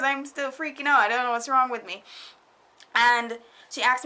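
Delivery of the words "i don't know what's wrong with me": ordinary delivery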